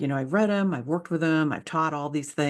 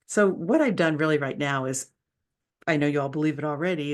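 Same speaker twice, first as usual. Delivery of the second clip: ordinary talk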